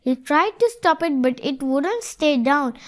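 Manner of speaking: ordinary delivery, nothing marked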